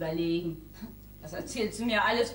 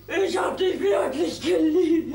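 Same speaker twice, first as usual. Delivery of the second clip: tearful outburst; 4 semitones higher, 5 dB louder, slower than usual. Acted.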